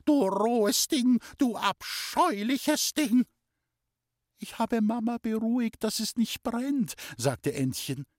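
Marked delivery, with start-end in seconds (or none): 0.0-3.2 very high, slow, furious name-calling; screamed abuse. A narrator's reading. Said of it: "hat geschrien". 4.4-7.0 put-on high girl's voice, self-justifying; girl's reply. A narrator's reading. Girl explains herself to aunt.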